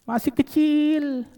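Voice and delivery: high, drawn-out plaintive answer; imitated grieving mother. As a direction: stretch it out like a mother's lament. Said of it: "sampai nangis sedih". (menirukan ibu meratap, nada tinggi dan panjang)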